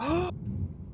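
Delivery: sharp high gasp of amazement. Real, not acted. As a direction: gasp in surprise. Amazed by the new tool.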